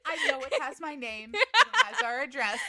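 mock-adamant protest breaking into laughter; adamant denial. Acting out Anna denying the contract is hers, adamant and laughing. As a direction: insist on innocence while giggling. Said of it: "I would be adamant".

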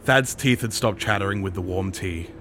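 usual speaking voice

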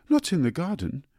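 mother cuts in, suddenly alarmed; alarmed interruption. Acted. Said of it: "interrupted her mother".

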